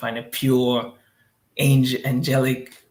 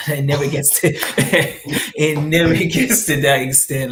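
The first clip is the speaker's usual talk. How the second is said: laughing; 6 dB louder than usual. Real, not acted. Laughing at the idea.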